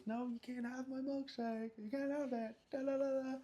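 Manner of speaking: put-on high, playful taunting kid voice; singsong schoolyard tease; childish teasing. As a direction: tease like a silly kid keeping his shake. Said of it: "and I'm like".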